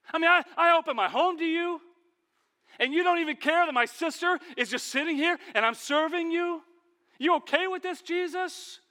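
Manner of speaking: high, loud hurt reproach; indignant accusation. Acted. Martha scolding Jesus in her home, loud high reproach. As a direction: accuse him at full pitch.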